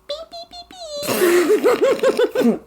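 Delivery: squeaky high beep noises. Pretend. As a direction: beep like a toy, laughing. Nipple pinching joke.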